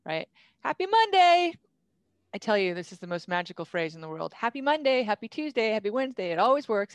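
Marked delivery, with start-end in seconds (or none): 0.6-1.5 high, loud, sung-out cheery hello; cheerful greeting. Shown as a demonstration. Demonstrating a magical email opener, cheerful greeting. 4.3-6.3 higher-pitched chirpy list, settling slightly; cheerful greeting. Shown as a demonstration. Listing email openers that always work, cheerful.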